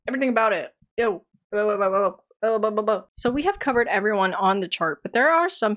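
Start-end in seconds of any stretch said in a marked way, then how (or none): none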